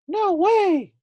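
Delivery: very high, loud squawk of refusal; squeaky hen. A narrator's reading. Hen refusing the giant, high squeaky defiance. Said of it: "said the hen".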